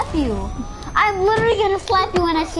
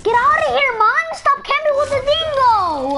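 high-pitched gamer outburst; real annoyed yell; 9 semitones higher than usual. Real, not acted. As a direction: yell at the camper to clear off. Yelling at camping opponent in game.

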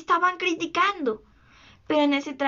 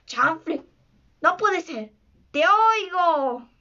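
higher, stretched-out mimicked captain voice; lightly put-on echoing voice; Garfio; 3 semitones higher, slower than usual. A narrator's reading. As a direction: imitate the captain, drawing out the last words. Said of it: "con la voz de Garfio".

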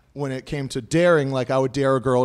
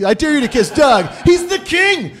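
high, excited mock shout; overeager hype man; 11 semitones higher, 3 dB louder than usual. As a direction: hype up your buddy, pitch leaping at the end. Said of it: "look like a guy that's, like, way into his friend making out".

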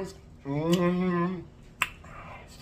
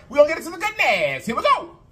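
hyped up; 11 semitones higher, faster than usual. Acted. Hyped lead-in before the song.

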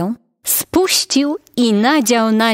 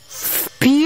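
howled through cupped hands; 6 semitones higher than usual. Acted. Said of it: "złożył dłonie w trąbkę i zawył".

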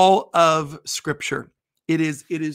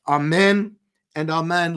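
usual speaking voice

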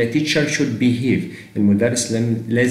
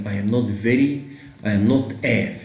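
slow, word-by-word English; slow teaching read; much slower than usual. Shown as a demonstration. Teacher to students, testing wrong options slowly. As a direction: lay out each word slowly. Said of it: "فين ال a بتاعت الاسم هنا؟".

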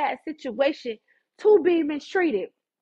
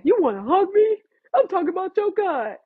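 high, loud, pushy invitation; insistent offer; 6 semitones higher, 4 dB louder than usual. Acted. Pressing scoffers for a hug, insistent. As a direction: push the offer loudly. Same offer again, more forceful.

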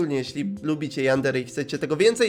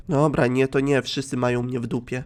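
ordinary talk

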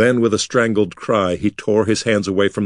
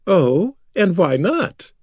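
teasing; 10 semitones higher, slower than usual. A narrator's reading. Bekla teasing Artek.

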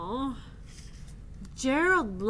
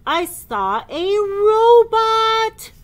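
building elephant wail; 7 semitones higher, 11 dB louder, slower than usual. Acted. Gerald voiced in book.

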